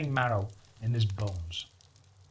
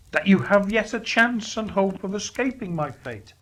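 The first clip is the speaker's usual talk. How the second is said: high, louder mournful spirit; Marley's ghost lamenting; 6 semitones higher, 4 dB louder than usual. Acted. Marley's ghost lamenting his chains to Scrooge, high and mournful. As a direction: wail out regret.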